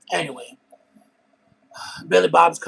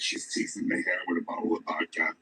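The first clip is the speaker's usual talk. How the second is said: much lower, far-off imitation of the deep-voiced woman; 6 semitones lower, 4 dB quieter than usual. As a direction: drop low into her raspy storytelling. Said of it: "talks like this".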